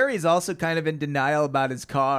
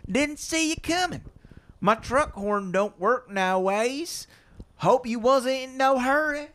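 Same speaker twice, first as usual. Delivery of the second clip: high, slow country farmer; rural farmer voice; 8 semitones higher, slower than usual. Acted. Reading the farmer's lines to the Hardys in a high country voice. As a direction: drawl it out like an old country farmer. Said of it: "So out comes the farmer".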